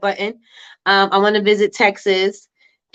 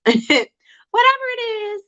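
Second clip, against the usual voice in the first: laughing; slower than usual. Real, not acted. Laughs about own accent.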